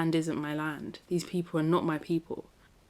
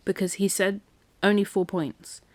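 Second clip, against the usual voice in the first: normal talking voice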